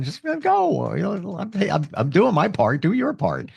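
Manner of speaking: an owner in the cold pleading with the dog, mock exasperated and high. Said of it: "pleading with dog".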